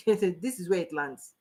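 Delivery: chuckling the punchline out; laughing. Real, not acted. To the audience, driving the point home, laughing.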